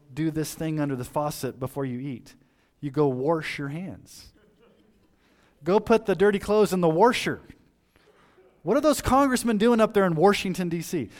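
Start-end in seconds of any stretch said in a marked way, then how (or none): 2.8-11.1 put-on folksy Missouri accent, warsh for wash; Missouri grandparent accent. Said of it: "I get a kick about how they used to talk".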